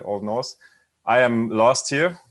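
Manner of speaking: ordinary delivery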